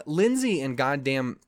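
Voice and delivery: normal talking voice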